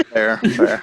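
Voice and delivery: laughing, high and loud. Real, not acted. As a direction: laugh the words out. Laughing at friend's bear joke.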